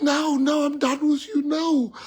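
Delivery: high-pitched mock refusal; upset wife. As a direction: act out the wife wailing her rejection. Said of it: "she was so angry".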